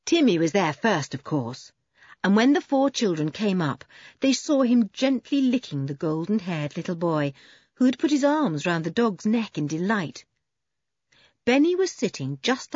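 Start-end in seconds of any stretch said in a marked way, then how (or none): none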